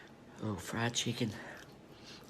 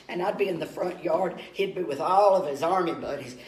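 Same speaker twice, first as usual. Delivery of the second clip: normal talking voice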